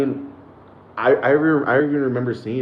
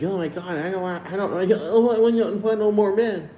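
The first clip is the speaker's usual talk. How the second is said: mocking hysterical girl; 11 semitones higher than usual. Vlogger mocking women shaving their heads, high squealing parody. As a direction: mimic her squealing that she's done with men. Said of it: "shaving their heads and being like".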